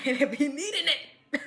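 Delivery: laughing through the line, high. Real, not acted. Joking that she needs God's blessing, laughing. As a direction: crack up at your own joke. Laughing at her own joke.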